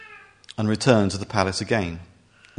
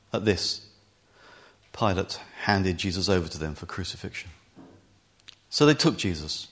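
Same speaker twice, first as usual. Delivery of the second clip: usual speaking voice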